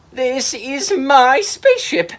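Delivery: high, loud and slow; indignant sea monster. Acted. Mira finding his spaceship occupied, loud indignant voice. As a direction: stretch it out like an offended giant.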